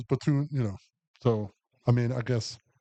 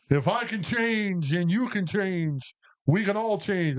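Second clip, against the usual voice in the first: a hint of Rocky, pitched higher; imitating Rocky; 7 semitones higher than usual. Quoting Rocky's rallying line to explain the movie, light Rocky impression. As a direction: lightly echo Rocky's big speech. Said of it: "That's Rocky said that".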